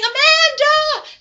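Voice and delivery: long, high, loud mock scream; stretched-out anguished yell, acted; screaming a name. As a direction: cry out for a girlfriend in hammed-up horror.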